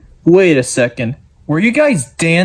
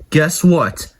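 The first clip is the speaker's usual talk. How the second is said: normal talking voice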